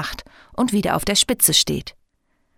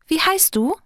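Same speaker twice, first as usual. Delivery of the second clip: high, insistent fairy question; nosy, pitched well up; pestering; 10 semitones higher than usual. A narrator's reading. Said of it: "nervt sie weiter".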